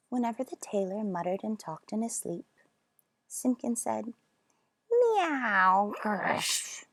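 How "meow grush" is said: a strange meow then a grumbling grush; eerie cat noises at night. Acted. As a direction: make horrid night cat sounds. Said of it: "made strange, horrid noises".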